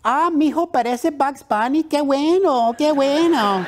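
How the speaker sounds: high-pitched, fussing mother in Spanish; doting Mexican mom. Retold or imitated. Mom praises her son's cartoon voice, high doting mother voice. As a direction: gush proudly like an encouraging mama. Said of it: "And my mom, God bless her".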